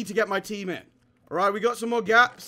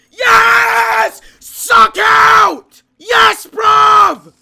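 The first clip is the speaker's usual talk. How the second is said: high, loud screamed celebration; victory scream; 18 dB louder, much slower than usual. Real, not acted. Gamer screaming in joy after killing the enemy.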